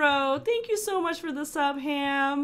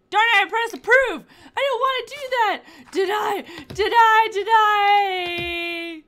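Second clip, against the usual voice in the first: loud, high exclamations of dismay; frantic, emphatic repeated shouts; exasperated outcry; 4 semitones higher, 4 dB louder than usual. Real, not acted. Player who pressed approve by mistake, frantic outcry. Wrong stamp pressed in game.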